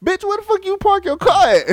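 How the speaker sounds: very high, loud, incredulous scold; exasperated yell. Retold or imitated. Man scolding girlfriend for parking there, loud exasperated yell. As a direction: bark at her in disbelief.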